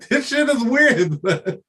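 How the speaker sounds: amused disbelief, laughing. Real, not acted. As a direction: chuckle it out.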